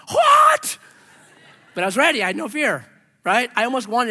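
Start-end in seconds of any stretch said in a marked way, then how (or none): 0.1-0.8 a much higher, louder cry of disbelief; incredulous shout. Retold or imitated. Retelling reaction to the inspector's verdict, incredulous shout. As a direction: yelp it out in shock. Inspector says inch is fine.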